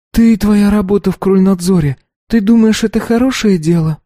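put-on high, light girlish voice; young girl voice. A narrator's reading. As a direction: voice the earnest teenage daughter. Narrator voices the daughter.

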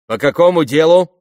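very loud, high interrogating question; sharp demand. A narrator's reading. Checkpoint soldier questions the driver, sharp demand. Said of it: "потребовал резкий голос".

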